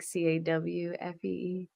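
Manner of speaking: spelled C A W F E E; Philly coffee spelled. Shown as a demonstration. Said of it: "we say it, like".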